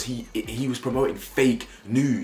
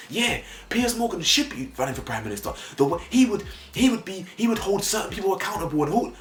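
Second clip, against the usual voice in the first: mock Twitter fan; 8 semitones higher than usual. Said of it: "seeing people on Twitter like".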